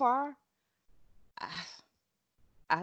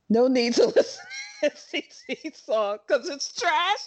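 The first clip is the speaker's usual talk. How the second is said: higher, louder, cracking up mid-sentence; laughing read-aloud; 6 semitones higher, 6 dB louder than usual. Real, not acted. Co-host reading friend's rude text out loud, breaking into laughter. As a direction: read it out through real laughter.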